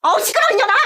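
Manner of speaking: high, loud insulting shout; furious yell. Acted. Aunt screaming back at her niece.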